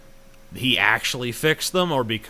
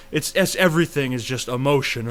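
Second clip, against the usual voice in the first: usual speaking voice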